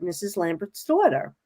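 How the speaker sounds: whispered Missus Lambert's daughter; hushed reveal. Retold or imitated. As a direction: drop to a whisper for the secret. Producer's revelation, voice dropped.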